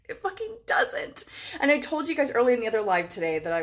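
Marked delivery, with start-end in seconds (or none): 0.1-1.2 high, breathy whispered insistence; emphatic whisper. Real, not acted. Insisting to viewers she is done with hotels, in a whisper. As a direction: hiss it out, slow and certain.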